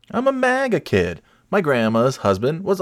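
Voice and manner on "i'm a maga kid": high mocking squeak; mock them in a squeaky voice. Mocking maga kid voice.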